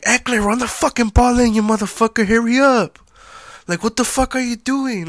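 high, loud mock yell at the player; retold screaming fan. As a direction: scream at the team like a drunk fan in the stands. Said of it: "Like, fucking screaming".

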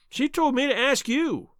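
high, plaintive little man; helpless high-pitched protest; whining protest. A narrator's reading. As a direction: complain in a high, whiny voice.

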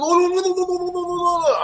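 high, sustained mock French Canadian babble; hammed-up French Canadian jabber, held high; French Canadian impression. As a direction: imitate a French Canadian in a high, rolling stream. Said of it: "that's my fucking best sound impression".

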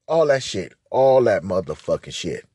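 normal talking voice

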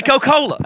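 Coca-Cola squeezed into "Co-cola"; Southern pronunciation. Shown as a demonstration. Insisting on the local way, Southern pronunciation. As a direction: say Coca-Cola as three syllables, "Co-cola". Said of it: "That's how we say".

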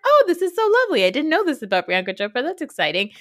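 high, fast gushing; mock-enthusiastic, gushing at first; a hammed-up delighted fan. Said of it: "And I was like".

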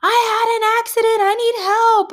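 put-on high, loud child's cry for help; panicked student. Student sprints out of the bathroom, high loud alarm. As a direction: wail it out high like an alarmed kid. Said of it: "sprints into the middle ... and goes".

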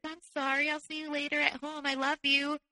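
high, lilting imitation of sweetness; mock-sweet apology. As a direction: imitate a partner suddenly all sweet. Boyfriend's sudden sweet goodbye, acted out.

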